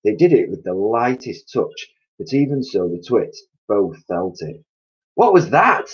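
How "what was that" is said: high, loud startled cry. A narrator's reading. Missus Twit feeling something land on her head, high startled cry. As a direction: cry out at a sudden touch on the head. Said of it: "cried Missus Twit".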